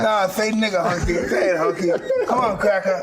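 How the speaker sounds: high, loud, mocking taunt; egging the interviewer on with a jeering chant; taunting demand.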